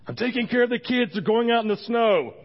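loud, high mock holler; shouted reply. Randall answering while minding the kids, retold as a holler. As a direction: imitate hollering back from another room.

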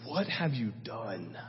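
low, soft, slow grave rebuke; solemn low reproach; stern prophet. Retold or imitated. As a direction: drop the voice and let it land heavy. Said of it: "He goes".

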